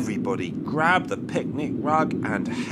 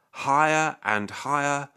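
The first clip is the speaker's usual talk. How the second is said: plain reading voice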